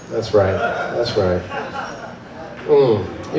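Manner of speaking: deep, soft purring drawl; low purr. Acted. Satan purring approval at the crowd.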